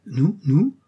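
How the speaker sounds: rising disbelief at being accused; feigned innocence. A narrator's reading. Schoolboy questioned about his laughter, surprised innocence.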